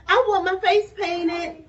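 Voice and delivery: put-on a little girl's loud, high plea; little sister begging to have her face painted, loud and eager; eager cry. A narrator's reading. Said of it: "cried Sam".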